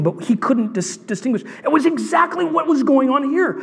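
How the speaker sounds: high, fervent preacher's voice; impassioned preaching. Real, not acted. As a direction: drive the point home with high-pitched urgency.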